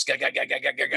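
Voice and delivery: rapid repeated engine sputter; imitated engine noise. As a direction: imitate an engine chugging into life. Imitates engine sputtering back to life.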